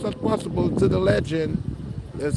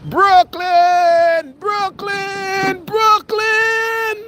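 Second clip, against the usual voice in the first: proud yell; 12 semitones higher, 5 dB louder, much slower than usual. Real, not acted. Vlogger yelling his borough's name to the boardwalk, high and loud. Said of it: "I should just yell".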